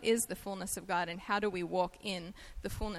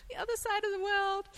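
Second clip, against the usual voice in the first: amused punchline; 13 semitones higher than usual. Acted. Playful punchline of accent story.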